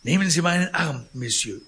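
high, loud fussy protest; concierge voice. Acted. Concierge protests to Pons, loud and fussy. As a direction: act the flustered concierge.

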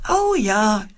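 a bright little shout of delight, lightly voiced; joyful cheer. Paulchen cheers at the letter game, mildly delighted. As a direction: cheer like a happy child. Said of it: "jauchzt Paulchen".

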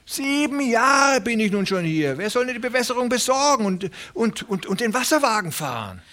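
high, fast, fretful little tenant pleading; a nervous little farmhand begging not to lose his place; Hooven the anxious tenant. Acted. Little tenant farmer pleading with Presley, high and anxious.

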